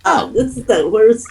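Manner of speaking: ordinary talk, nothing marked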